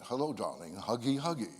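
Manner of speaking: deep, soft broadcaster voice; sweet talk like a radio anchor; deep radio voice to a girlfriend; announcer voice to girlfriend. Retold or imitated. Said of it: "I couldn't get out of that voice".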